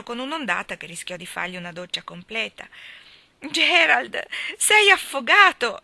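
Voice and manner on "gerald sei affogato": high, loud and slow, panicked wail; frantic exclamation. Acted. Little sister wailing at her soaked brother, panicked high cry. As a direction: cry out as if he has already drowned. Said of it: "esclamò Geraldine".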